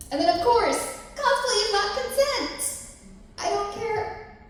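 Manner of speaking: high, loud, slow mock rant; mock outraged shout. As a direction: belt it out like a mock tirade. Loud mock rant about cosplay.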